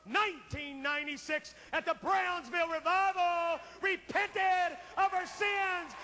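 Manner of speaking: loud revival preacher, surging; preaching at full force. Acted. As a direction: drive the altar call home like a revival preacher.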